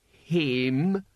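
one word pushed out with effort; strained. A narrator's reading. Cohen finishes the difficult answer, strained. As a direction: labour over a foreign idea. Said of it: "lips tensed around the word".

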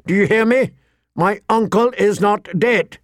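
high, forceful, angry challenge; ringing anger. A narrator's reading. Young lord with hand on sword, angrily defiant. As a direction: let the outrage ring off stone walls. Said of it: "His voice rang against the stone walls".

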